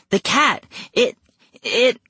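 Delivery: high, breathless sputter. Acted. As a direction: sputter in panic. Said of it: "I sputtered".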